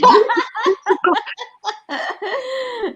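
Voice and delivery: bright peal of laughter, high up; real laugh at the joke. Real, not acted. Laughs at never seeing family.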